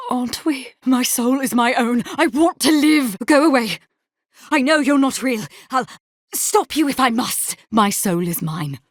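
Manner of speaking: high, agitated plea against a spirit; distraught defiance. Acted. Tormented soul telling a spirit to go away, distraught. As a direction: fight off a vision in rising panic.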